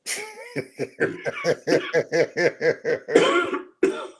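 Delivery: long high-pitched laugh; helpless run of laughter. Real, not acted. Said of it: "I'm, I'm laughing".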